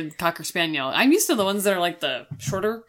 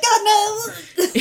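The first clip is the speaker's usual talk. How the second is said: laughing mock cry; 12 semitones higher than usual. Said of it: "I was like".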